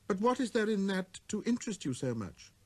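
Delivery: put-on a small boy musing to himself; little prince. Prince voiced.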